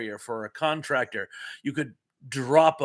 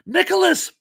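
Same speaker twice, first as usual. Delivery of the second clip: much higher, louder squeal of joy; thrilled shock; 6 dB louder than usual. Real, not acted. Host sees Nicholas join the stream, thrilled squeal. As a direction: shriek like a long-lost friend walked in. Old friend arrives in chat.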